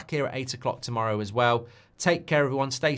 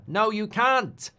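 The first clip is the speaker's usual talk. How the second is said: high, loud, drawn-out South African "can't" with a short a; South African accent; 11 semitones higher, 5 dB louder, much slower than usual. Acted. Streamer trying the word on request, exaggerated South African accent. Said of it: "South African accent".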